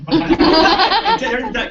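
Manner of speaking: real laughter breaking through; laughing. Real, not acted. Chiropractor cracking up telling the poop story, laughing. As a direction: laugh out the words.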